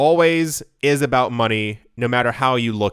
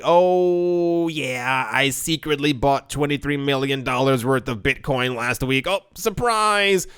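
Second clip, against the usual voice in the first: smug rich person; slower than usual. Retold or imitated. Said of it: "a rich person who's like".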